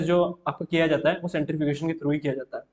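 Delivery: ordinary talk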